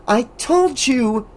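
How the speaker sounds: high, slow insistence; insisting. A narrator's reading. Alice insisting to Debbie. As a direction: press the point. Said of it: "Alice insisted".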